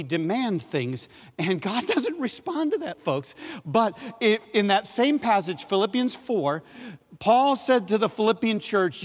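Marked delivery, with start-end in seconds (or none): none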